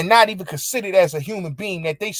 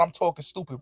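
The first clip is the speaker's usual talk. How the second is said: softer, scornful echo of her words; scoffing murmur of the claim; mocking echo; 10 dB quieter, faster than usual. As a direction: repeat her accusation under your breath. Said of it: "The fuck you talking about?".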